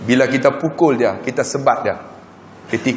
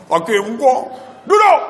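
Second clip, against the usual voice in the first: stern order; 8 semitones higher than usual. Retold or imitated. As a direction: bark the order down. Father ordering sick son sit.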